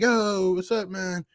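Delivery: mock-call from the stands to a player; eager fan calling out. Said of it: "I'm gonna be that dude going over like".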